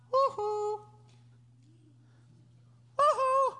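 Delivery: very high sing-song hoot; playful calling. Retold or imitated. God calls after runaway Jonah, high teasing coo. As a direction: coo it high to get someone's attention.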